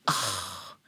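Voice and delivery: whispered sigh. Acted. Doting over the lovers.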